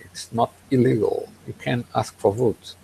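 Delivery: ordinary talk, nothing marked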